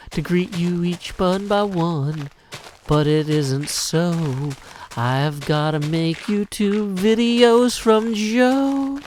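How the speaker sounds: slow Southern drawl, Daniel Craig style; a lightly done Kentucky detective accent. Acted. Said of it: "It's Daniel Craig's accent from Knives Out".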